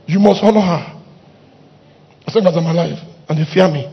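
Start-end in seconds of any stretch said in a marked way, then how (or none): none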